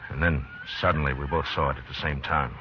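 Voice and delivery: low, even narrator voice over music; story narration. A narrator's reading. [name] narrating for the listener, tense narration. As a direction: tell it like a tense western tale.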